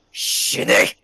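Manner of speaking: Shini! spoken in Japanese, forced and breathy; Japanese word. A narrator's reading. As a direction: say Shini! sharp and unvoiced. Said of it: "in Japanese".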